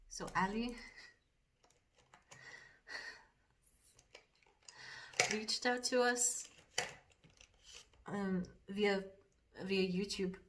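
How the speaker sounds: put-on lightly done Geordie accent, a bit fast at first; chatty Geordie accent while doing makeup; a hint of Geordie accent in casual chat. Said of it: "that's about as much of the accent".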